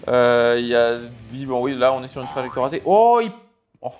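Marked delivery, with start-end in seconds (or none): 2.8-3.9 surprised. Real, not acted. Crash happens on screen.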